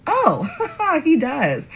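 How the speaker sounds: high laugh through the words. Real, not acted. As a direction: laugh it out in disbelief. Laughing at his kiss attempt.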